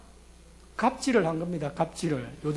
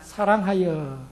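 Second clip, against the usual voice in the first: drawn out slowly for the congregation; slow call and response; 2 semitones lower, much slower than usual. Shown as a demonstration. Preacher leading the congregation to answer, slowly. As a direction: stretch it so they answer along. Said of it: "그가 레아보다".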